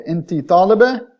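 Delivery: "inti taliba" loud, pitch climbing high on taliba; rising question intonation. Shown as a demonstration. Teacher correcting the example to feminine, rising question intonation. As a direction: ask "inti taliba" with a strong final rise. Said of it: "rising intonation indicating that you have a question".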